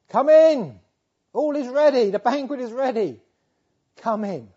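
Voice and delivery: loud, high ringing call; joyful loud invitation to the banquet. Acted. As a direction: call them in from across the hall. Preacher calls out the invitation.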